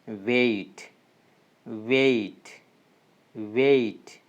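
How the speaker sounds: weight said three times, slow, the ei gliding clearly; drawn-out diphthong demo. Shown as a demonstration. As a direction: glide the vowel in weight from e to i. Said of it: "as in the word we have".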